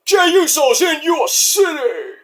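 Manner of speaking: imitating Jey hyping the crowd, slow and high; mock Jey Uso hype. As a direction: drag it out like a crowd-pumping entrance line. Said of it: "And now he's like".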